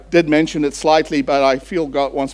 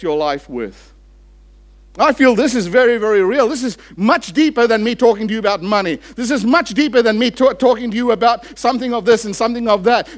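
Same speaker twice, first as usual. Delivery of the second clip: raised, higher, louder sermon voice; fiery preaching; 9 semitones higher, 4 dB louder than usual. Acted. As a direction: preach it with rising fervour to the congregation. Preaching at full force.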